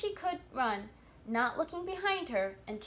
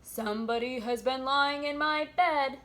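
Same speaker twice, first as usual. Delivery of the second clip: a hint of papa bear, louder; lightly voiced big bear, raised volume; great gruff bear; 3 semitones higher, 6 dB louder than usual. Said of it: "in his great gruff voice".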